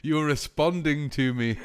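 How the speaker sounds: loud, slow, high delight. Real, not acted. Streamer thrilled that chat replies, slow loud delight.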